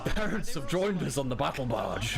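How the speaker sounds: lightly voiced space marine announcement; a hint of a solemn space marine. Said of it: "I'm starting to sound like a space marine now".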